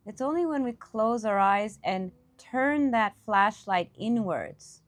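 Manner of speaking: ordinary delivery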